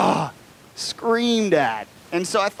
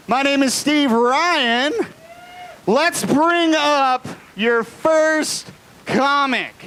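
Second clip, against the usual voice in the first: slow, higher emcee intro; announcer voice; 8 semitones higher, much slower than usual. Acted. Comedy club host introduces himself, slow announcer voice. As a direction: boom out the host intro to the room.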